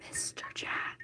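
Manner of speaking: breathy whisper. Acted. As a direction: whisper it secretly. Whispered teasing aside.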